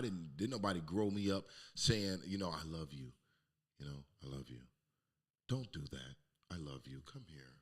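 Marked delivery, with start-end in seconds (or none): 2.5-4.6 soft, low "parent" voice; tender. Pretend. Voices loving parent's words. 5.5-7.5 low, soft parental coaxing; tender. Pretend. Voices loving parent's words.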